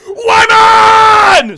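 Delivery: long, high goal scream; full-volume celebratory scream. Real, not acted. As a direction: scream the player's name, drawn out. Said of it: "I'm so sorry for screaming".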